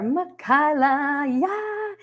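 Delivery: high, drawn-out cheer; excited greeting. Real, not acted. Host spots a viewer joining from England, excited greeting. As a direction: stretch the name out in a high, delighted call.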